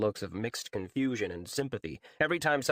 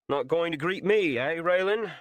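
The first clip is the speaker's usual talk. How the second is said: scoffing; 7 semitones higher, 4 dB louder than usual. A narrator's reading. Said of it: "Tam scoffed".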